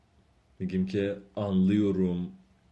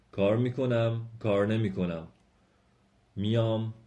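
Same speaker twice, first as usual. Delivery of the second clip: usual speaking voice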